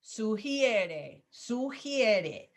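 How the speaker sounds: sugiere said slowly as su-hi-E-re, stress on E; clearly sounding out sugiere for the student; model pronunciation. Shown as a demonstration. Teacher correcting a student's pronunciation, slow and clear. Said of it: "it's the pronunciation that tripped you up there".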